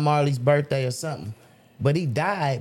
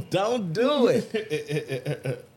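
very slow warning through laughter; drawn-out warning; 5 semitones higher, much slower than usual. Retold or imitated. Imitating the inner voice warning him while laughing. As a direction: stretch each word as a warning. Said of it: "it's authoritative".

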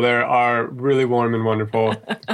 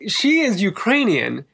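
exasperated rant; 8 semitones higher than usual. Retold or imitated. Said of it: "I was like".

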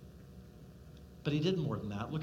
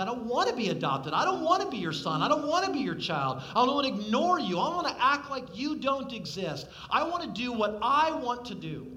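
put-on louder, higher, rebellious child pushing away; defiant refusal; 5 semitones higher, 4 dB louder than usual. Rebellious child defiantly refusing his adoptive father. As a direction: hurl the refusal back at him. Rejecting God who wants to adopt.

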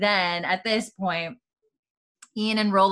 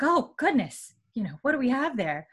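put-on a higher, genteel old lady voice; lightly voiced old aunt; 6 semitones higher than usual. Aunt greeting a barking dog, higher genteel voice. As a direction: lift the pitch like a gracious elderly hostess. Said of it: "she's like".

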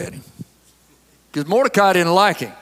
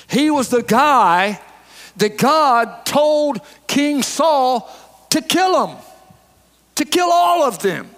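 1 preaching at full force; 6 semitones higher, slower than usual. Real, not acted.

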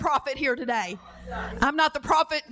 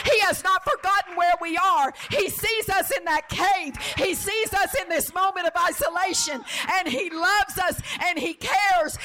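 impassioned preaching; 5 semitones higher than usual. Real, not acted. Preacher assuring the congregation God sees them, impassioned. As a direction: declare it to the congregation with rising conviction.

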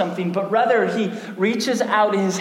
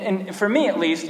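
ordinary delivery

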